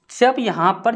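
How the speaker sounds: normal talking voice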